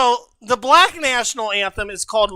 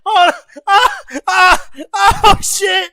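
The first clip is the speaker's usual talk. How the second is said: laughing; 10 semitones higher, 5 dB louder, slower than usual. Real, not acted. Voice just cracked while singing.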